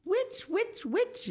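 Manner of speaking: very high, squawky calling; mock yell. A narrator's reading. Gideon calls from the porch, high mock yell. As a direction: call out in a high voice without much volume. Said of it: "yelled Gideon from the porch".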